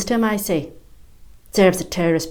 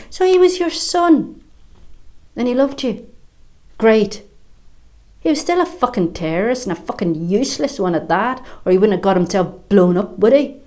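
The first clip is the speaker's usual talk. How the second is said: higher, louder, biting contempt; bitter scorn; 4 semitones higher, 4 dB louder than usual. Acted. Woman scorning the bomber's mother, angry. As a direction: throw it back in her face.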